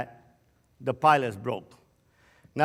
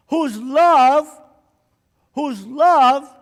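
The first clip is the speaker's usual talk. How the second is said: loud, high revival preaching; preaching at full force; 9 semitones higher, 8 dB louder than usual. Acted. Preacher rousing the gentlemen in the audience, loud and fervent. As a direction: build it up, preach to the crowd.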